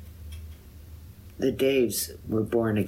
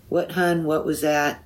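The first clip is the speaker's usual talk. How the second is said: calling from next room; 8 semitones higher, 4 dB louder than usual. A narrator's reading. Dave calls from next door.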